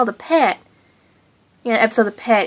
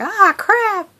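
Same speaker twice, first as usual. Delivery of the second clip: higher mock grumble; annoyed minions; 9 semitones higher than usual. Watchdogs watching the heroes escape, higher mock grumble. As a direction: mutter it like a fed-up henchman. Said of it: "the watchdogs are like".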